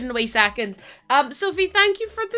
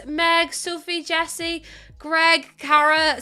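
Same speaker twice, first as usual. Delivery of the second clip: usual speaking voice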